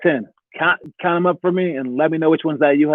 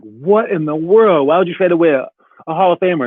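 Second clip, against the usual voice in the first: incredulous outburst; 2 semitones higher, 3 dB louder than usual. Retold or imitated. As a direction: relive your disbelief as an outraged fan. Acts out dismay at the trade.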